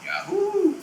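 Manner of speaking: high, bright calling "Yahoo!". Shown as a demonstration. Guide spots the Yahoo puppet and calls its name out high. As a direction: sing out the creature's name way up high. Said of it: "look, there's the Yahoo".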